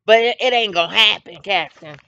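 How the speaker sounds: a protective mother stopping a bum cold; sassy mama. Pretend. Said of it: "she got a mama that's gonna say".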